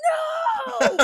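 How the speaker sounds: high, loud mock shout; laughing cry of protest; anguished no. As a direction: wail it like your stuff is gone. Makeup thrown away, retold outcry.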